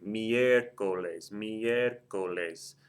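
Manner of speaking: miércoles very slowly, stress on the MIÉR; slow miércoles, leaning on the first syllable. Shown as a demonstration. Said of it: "The E is the third or more syllable back".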